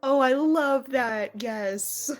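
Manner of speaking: ordinary talk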